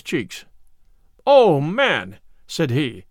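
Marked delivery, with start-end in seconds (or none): none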